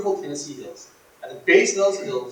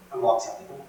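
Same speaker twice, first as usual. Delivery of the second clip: normal talking voice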